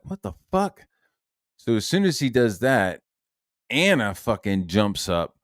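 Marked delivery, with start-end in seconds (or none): 0.0-0.9 high, startled what the fuck; stunned disbelief. Retold or imitated. Recalling his reaction to the posted screenshot, high disbelief. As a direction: react like you can't believe it. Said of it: "I'm like".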